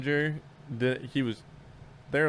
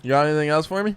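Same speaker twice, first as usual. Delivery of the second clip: louder, higher, rising challenge; loud pointed retort; 4 semitones higher, 9 dB louder, much faster than usual. Retold or imitated. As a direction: throw the question back loudly, pitch climbing. Acts out his pointed retort to boss's son.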